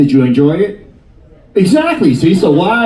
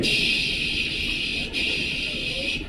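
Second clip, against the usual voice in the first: shower water shush; 15 dB quieter, much slower than usual. Retold or imitated. Imitating shower water sound.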